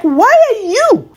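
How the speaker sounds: exaggerated retold protest; retold indignant challenge. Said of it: "she's like".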